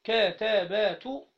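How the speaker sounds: sounding out كا تا با تو as separate, fully voweled syllables; syllables one by one. Shown as a demonstration. Teacher sounding out كا تا با تو letter by letter. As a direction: spell out كا، تا، با، تو slowly, each letter with its vowel. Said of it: "طب انطقها كده".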